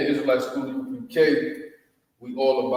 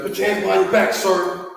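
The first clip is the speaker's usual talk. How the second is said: higher, louder barked police command; imitating a police officer; 7 semitones higher, 5 dB louder, faster than usual. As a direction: bark it like a cop making an arrest. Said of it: "like, you know how police".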